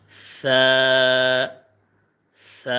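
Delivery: light soft sa held one second; soft thin sa. Shown as a demonstration. Teacher showing students the soft Arabic sa. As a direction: breathe out a thin gentle sa. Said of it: "এক second টানবো আর হালকা করে".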